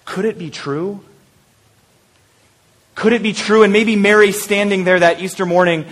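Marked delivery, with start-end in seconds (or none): 0.0-1.0 quiet, wondering question; hushed wonder. Acted. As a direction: ask softly, barely daring to believe. Wondering, then repeated in full voice.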